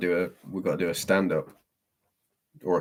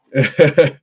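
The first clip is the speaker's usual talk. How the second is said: short loud high laugh; 7 semitones higher, 11 dB louder than usual. Real, not acted. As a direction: burst out laughing briefly. Reacting to impression requests.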